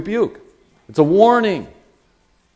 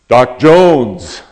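booming call from across a room; hearty greeting; 13 dB louder than usual. Retold or imitated. Man hails his friend from behind me, booming call. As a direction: hail an old friend very loudly. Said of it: "this voice goes".

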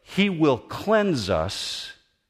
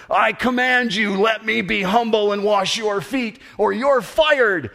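petty dictator; 8 semitones higher, 3 dB louder than usual. Retold or imitated. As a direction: lord it over him like a tin-pot boss. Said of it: "the petty response of a dictator".

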